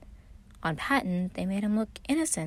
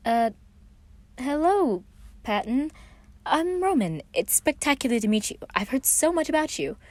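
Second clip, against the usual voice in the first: higher, bright charming hello; turning on charm; 8 semitones higher than usual. A narrator's reading. Roman meeting Patton, putting on his charm with a higher voice. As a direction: lay the charm on thick. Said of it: "turning on his usual charm".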